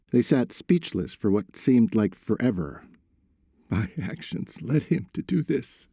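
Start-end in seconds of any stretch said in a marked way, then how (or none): 3.7-5.9 higher, subdued tearful voice; squeaking through tears. A narrator's reading. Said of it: "Tony squeaked through his tears".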